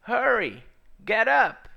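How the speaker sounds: high, loud urgent call; urgent angel call. Acted. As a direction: wake him up fast, loud and high. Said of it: "The angel said". (anjo chamando alto e com urgência)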